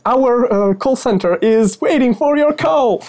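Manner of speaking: high, bright hold-line message; call center voice. Pretend. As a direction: play the automated phone queue.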